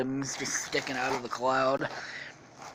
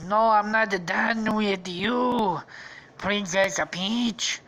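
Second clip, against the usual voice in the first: slow, drawn-out, raised threat; menacing villain voice; 5 semitones higher, 3 dB louder, slower than usual. Acted. Gunman threatening the princess, slow menacing voice. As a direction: stretch it out as a cartoon villain. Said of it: "She's like".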